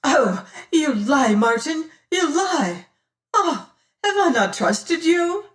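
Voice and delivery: high, loud and pleading; anguished accusing cry. Acted. Damaris cries out at Martin, high and anguished. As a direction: cry out, betrayed and desperate.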